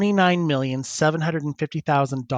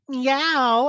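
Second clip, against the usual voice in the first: cat meow; 10 semitones higher than usual. Retold or imitated. Fursuiter meowing at desk.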